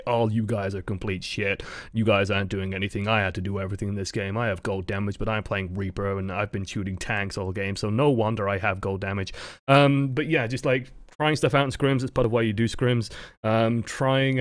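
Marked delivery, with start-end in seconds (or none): 0.1-9.3 low, droning mock complaint; monotone Reaper player. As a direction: imitate a whiner in a dull monotone, pitched down. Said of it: "talking in a very monotone voice".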